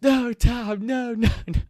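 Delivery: high, loud, panicked no's; a mock cry of dismay; imitating a distressed protest; retold yell from upstairs. Kid yelling from upstairs.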